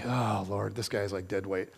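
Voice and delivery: deadpan mock complaint, pitched low; exasperated aside. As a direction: sigh it out to God, fed up. Elisha exasperated with the panicking man.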